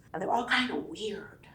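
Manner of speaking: a hushed, voiceless aside; whispered aside. Real, not acted. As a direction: drop to a secret whisper. Confiding aside about channelers.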